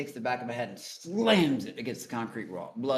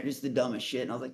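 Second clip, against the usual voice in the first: normal talking voice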